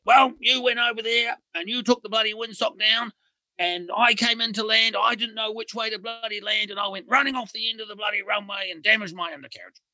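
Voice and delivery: hammed-up blaming whine; retold angry rant. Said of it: "he bails the whole Kiwi team up".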